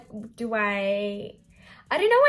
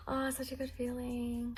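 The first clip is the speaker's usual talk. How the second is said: soft delighted gasp; 3 semitones higher, 8 dB quieter than usual. Real, not acted. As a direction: breathe out in delight. Admiring her lips.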